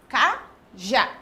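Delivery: "Cajá" as two separate beats, a gap between ca and já; "Cajá" broken slowly into ca, já; split into syllables. Shown as a demonstration. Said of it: "tem quantas sílabas?".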